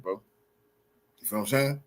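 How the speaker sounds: ordinary delivery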